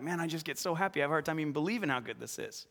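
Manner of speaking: soft, marvelling aside; awed disbelief. Acted. Believer too happy to believe it, softly marvelling. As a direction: say it softly, overwhelmed by good news.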